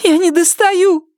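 the wife yelping in pain; cries of pain. A narrator's reading. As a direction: squeal as he pulls her hair. Myrtle pleading.

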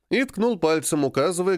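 usual speaking voice

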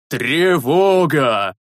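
alarm shout. A narrator's reading. Priest raising the alarm.

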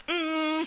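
high stubborn "mm", lips sealed; sustained humming through a shut mouth; closed-mouth hum. Acted. Mary shows how she will stay mute, stubborn hum. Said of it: "con la bocca chiusa".

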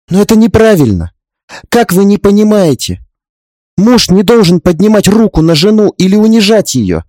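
impassioned protest. Acted. Heroine objecting to an older woman's submission, impassioned. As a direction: protest with rising urgency in a high voice.

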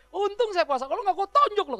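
eager half-joking threat; mock fired-up threat. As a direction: play it like a man barely holding back a punch. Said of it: "mukanya serius banget, udah semangat banget".

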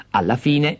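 ordinary delivery

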